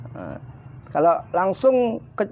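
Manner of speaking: ordinary delivery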